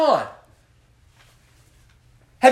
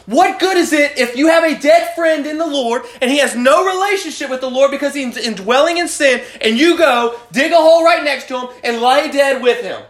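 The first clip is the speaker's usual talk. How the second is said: normal talking voice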